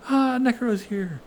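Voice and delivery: high, loud eager greeting; eager cheer. Pretend. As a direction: greet him like a hero. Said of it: "We're all gonna be like".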